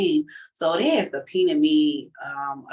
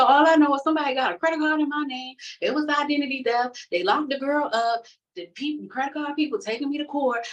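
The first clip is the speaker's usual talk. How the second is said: higher, fast, pleading explanation; urgent explanation; 9 semitones higher than usual. Retold or imitated. She explains the identity theft to the hiring lady, high and rushed. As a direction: rush to clear your name.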